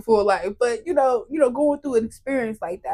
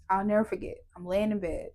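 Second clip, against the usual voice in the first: ordinary talk, nothing marked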